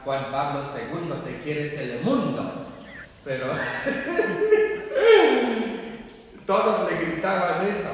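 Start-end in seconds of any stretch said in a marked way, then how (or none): 3.5-6.4 high, loud burst of laughter. Real, not acted. Priest amused by his misheard chant, loud real laughing. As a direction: crack up at your own joke.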